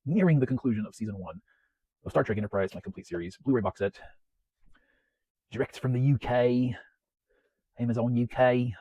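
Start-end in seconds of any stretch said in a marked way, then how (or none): 5.5-8.7 hammed-up, badly faked British accent; shitty British accent. Vlogger telling viewers where the box set was bought, fake British accent. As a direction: put on a bad British accent. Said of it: "that is my shitty British accent".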